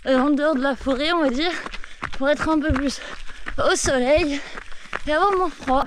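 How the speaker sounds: talking while jogging, a little breathless; while running. Real, not acted. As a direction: speak mid-run, between strides. Filming while jogging in forest. (en pleine course, un peu essoufflée)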